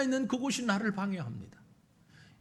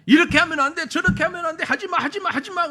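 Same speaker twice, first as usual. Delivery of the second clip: nagging scold; 6 semitones higher, 5 dB louder, faster than usual. Acted. Voicing the crowd's prohibitions.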